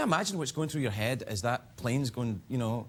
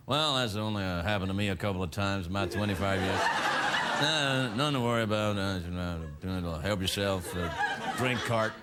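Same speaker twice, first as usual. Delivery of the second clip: lower, dry and unbothered pilot; 9 semitones lower than usual. Retold or imitated. Comedian imitating pilot over intercom, dry deadpan calm. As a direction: play it deadpan like a bored captain. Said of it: "in that very dry voice".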